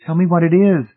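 high, loud, sharp insistence; sharp demand. A narrator's reading. Wife demanding the truth from her husband, sharply. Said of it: "Marcia said sharply".

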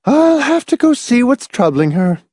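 very high-pitched, fussy woman's voice; sighing matron. A narrator's reading. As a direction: excuse yourself with fussy concern. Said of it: "Missus Pritchett sighed".

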